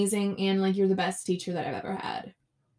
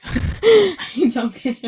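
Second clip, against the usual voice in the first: loud, slow laughing I don't care; loud giggling shrug-off; 4 semitones higher, 9 dB louder, much slower than usual. Real, not acted. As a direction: laugh it out defiantly. Laughing defiance about not studying.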